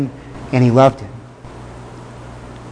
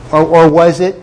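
ordinary talk

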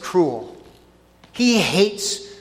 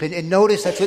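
ordinary talk, nothing marked

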